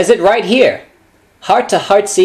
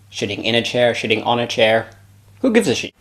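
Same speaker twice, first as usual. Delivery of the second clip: Sean Connery impression; 6 semitones lower, 4 dB quieter than usual. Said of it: "one of my famous impressions, this time of Sean Connery".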